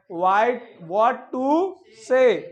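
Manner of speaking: teacher dictating slowly; slow drill. Shown as a demonstration. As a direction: spell it out for repeating. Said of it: "आपको repeat करना है मेरे साथ".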